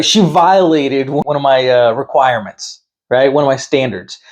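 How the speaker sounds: ordinary talk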